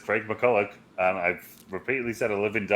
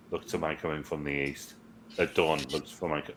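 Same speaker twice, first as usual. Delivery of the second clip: hushed quoted line; 9 semitones lower, 10 dB quieter than usual. Retold or imitated. Quoting Gandalf's film line.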